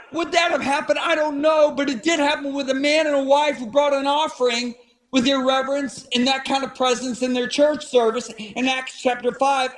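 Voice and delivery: normal talking voice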